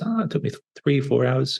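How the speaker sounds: lightly voiced fisherman, pitched well up; a casual fisherman telling how long the catch took; fisherman's answer. Fisherman voiced in retold story.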